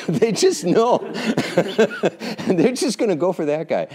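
high, loud words through laughter; real laughing fit. Real, not acted. Speaker joking about lions picking the straggler, helpless laughter. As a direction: crack up while getting the words out. Cracks up at own lion analogy.

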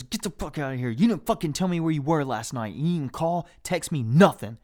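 heated mock tirade, building in pitch and force; imitated angry mom. Mom chewing out son before his friends, imitated angry scolding. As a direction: lay into him like a furious mom. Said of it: "just getting in my ass".

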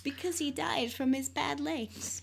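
high, hushed fake grief; hammed-up hushed lament; mock mourning. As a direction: play at tearful pity, softly and slowly. Mock mourning of Tiny Tim.

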